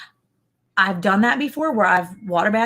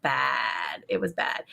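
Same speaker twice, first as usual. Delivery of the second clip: hushed disgust; 8 semitones lower, 8 dB quieter, much slower than usual. Real, not acted. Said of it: "disgust at burned food".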